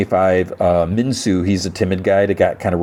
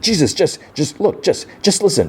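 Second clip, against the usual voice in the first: high, halting mock exasperation; exasperated pleading; 11 semitones higher than usual. Retold player pressing a woman to hear him out, exasperated. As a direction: stumble over it, pitched well up, urging.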